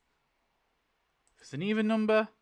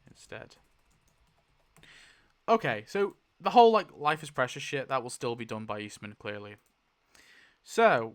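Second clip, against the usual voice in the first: usual speaking voice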